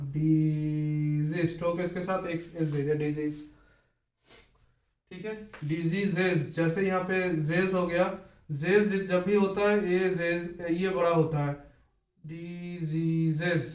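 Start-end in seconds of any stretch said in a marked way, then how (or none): 0.0-1.5 "डिजीज" stretched very slowly, both s sounds drawn out; drawn-out word. Shown as a demonstration. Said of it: "एस के साथ अगर आप एस बनाओगे". 12.2-13.6 "डिजीजेज" said very slowly, every syllable stretched; drawn-out word. Shown as a demonstration. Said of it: "तीन एस हो गया".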